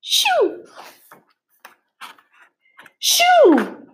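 high squeaky cry; squeaking. A narrator's reading. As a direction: squeak it out in alarm. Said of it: "Emma squeaked".

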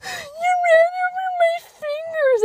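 put-on very high, squealed child's cry; imitated sobbing shriek of pain; mock crying wail. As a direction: wail it like a hurt kid. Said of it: "I'm crying on the ground".

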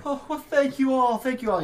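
high, loud grateful mother; a tearful mother gushing thanks. Acted. Mother thanking the companions, high and tearful.

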